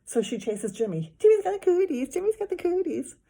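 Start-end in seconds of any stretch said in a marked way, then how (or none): 1.2-3.1 high, loud playground chant; sing-song schoolyard taunt; girl teasing. A narrator's reading. Girl chasing Jimmy, chanting high and loud.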